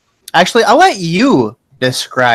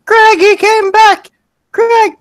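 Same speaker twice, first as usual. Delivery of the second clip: high, drawn-out holler into the house; shrieking excited mother; mom yelling; 5 dB louder, slower than usual. Acted. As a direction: call out high and loud for your husband. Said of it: "starts yelling into the, into the, uh, house".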